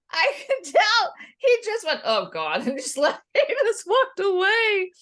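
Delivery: high and laughing through the words; real laughter breaking into the words; laughing while telling. Real, not acted. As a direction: giggle your way through the story. Laughing while recounting embarrassing story.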